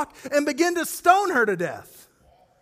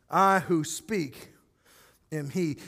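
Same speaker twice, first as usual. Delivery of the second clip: slowed solemn reading; 7 semitones lower, slower than usual. Shown as a demonstration. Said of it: "Jesus uses this phrase".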